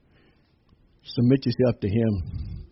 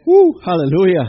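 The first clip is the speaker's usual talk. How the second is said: loud, high praise shout; exultant whoop; 5 semitones higher, 9 dB louder than usual. Real, not acted. Preacher praises after saying the lost world needs Jesus, exultant.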